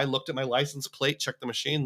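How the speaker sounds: ordinary delivery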